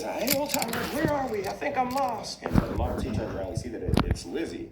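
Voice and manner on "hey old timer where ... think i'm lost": very high lost cartoon truck; Mater. Lost truck asks an old car, high character voice. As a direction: call out high, asking for help. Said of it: "And Mater says".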